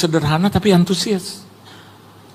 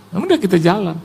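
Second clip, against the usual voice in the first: usual speaking voice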